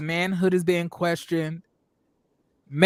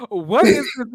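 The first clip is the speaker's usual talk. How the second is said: bewildered what is this; 3 semitones higher, 6 dB louder than usual. Retold or imitated. Speaker's shocked reaction retold.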